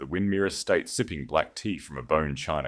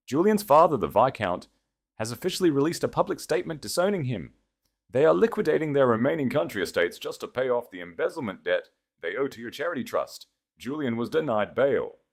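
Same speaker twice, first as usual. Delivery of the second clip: higher male voice, brisk and calm; Connor's voice; 8 semitones higher than usual. A narrator's reading. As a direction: relay the gossip coolly as the husband. Narrator voices Connor's news.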